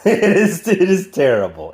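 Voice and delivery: amused burst, breaking into a laugh. Real, not acted. Reviewer admits the pilot is awful, laughing. Laughs while judging the episode.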